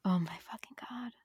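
hushed, voiceless swoon; awed whisper. Real, not acted. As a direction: whisper it, overcome by the plot. Swooning over the fake marriage plot.

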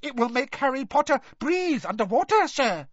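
very high, urgent elf voice; squeaky house-elf urgency; Dobby squeaking. A narrator's reading. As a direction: squeak it out in a panic to hurry him. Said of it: "squeaked the elf".

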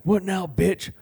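high, loud cocky taunt; a kid showing off after the fight. Retold or imitated. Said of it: "I'm like".

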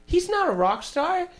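high mocking voice of a flirty singer; mock flirty rock star.

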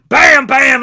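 loud punchy BAM, BAM; shouted impacts. Retold or imitated. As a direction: hit each word like a blow. Imitates knockout blows.

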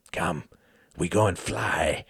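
ordinary delivery, nothing marked

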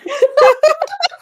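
a real laugh that cracks up high; high squealing laugh. Real, not acted. Laughing at son of a bitch.

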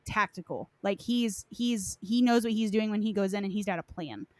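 ordinary talk, nothing marked

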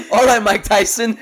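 high, loud laughing tease. Real, not acted. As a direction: laugh it out at a friend. Teasing lisp, laughing.

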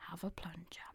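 breathless whispered tip. Real, not acted. Host whispering a tip to listeners. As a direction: whisper the advice.

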